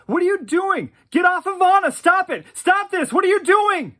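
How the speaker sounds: very high, loud frantic yell. Retold or imitated. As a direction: scream at him to get off Anna. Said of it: "Celia yelled at him".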